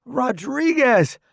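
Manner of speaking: high, drawn-out yell; shouting over bombs. A narrator's reading. Newbie introducing himself amid bombing, shouting. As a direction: shout your name over the noise. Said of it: "I shout over the loud whistles".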